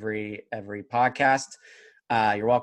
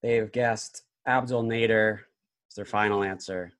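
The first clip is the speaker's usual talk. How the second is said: dramatic reality show hype; 3 semitones lower, slower than usual. Acted. Host builds game-show suspense.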